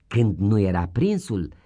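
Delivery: plain reading voice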